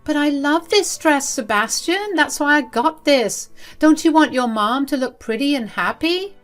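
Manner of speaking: high-pitched, wheedling protest; whining mother. A narrator's reading. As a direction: plead in a high, put-upon voice. Mother whines, appeals to husband.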